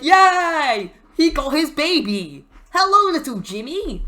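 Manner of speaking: high, loud mock cheer; crowd cheering. Crowd cheers R-Truth and his baby, high mock delight.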